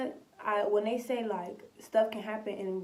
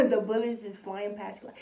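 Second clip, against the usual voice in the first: ordinary talk, nothing marked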